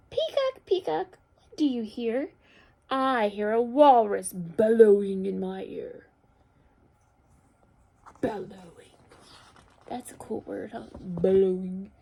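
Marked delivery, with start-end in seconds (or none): 0.1-6.1 picture-book read-aloud. A narrator's reading. Teacher reads walrus page to children, loud expressive storytelling. 11.0-11.9 bellowing. Shown as a demonstration. Teacher shows children how the word sounds, bellowing it. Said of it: "Sounds like you should say it like that".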